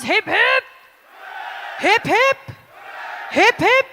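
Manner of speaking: high shouted hip hip; rising shouted cheer call; cheer leader call. Acted. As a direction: lead the cheer, punch each hip. Leading three cheers.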